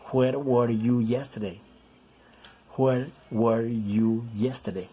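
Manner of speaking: slow, clear "Where were you yesterday?"; slow model reading. Shown as a demonstration. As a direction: read it slowly for the class. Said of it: "Es diferente".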